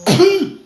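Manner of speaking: "pu" popped up high and short against a plain "pla". Shown as a demonstration. Drilling viewers on pu versus pla, "pu" squeaked high. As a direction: snap "pu" up high, keep it brief. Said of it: "sachez différencier la phonétique".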